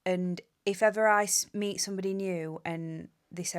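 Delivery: usual speaking voice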